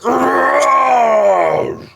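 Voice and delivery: huge high screaming roar in pain; giant's roar. Acted. The shocked fire giant screams out in agony. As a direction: let the giant bellow with pain. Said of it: "you can hear him roar. He's like".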